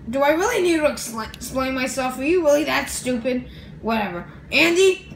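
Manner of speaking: ordinary talk, nothing marked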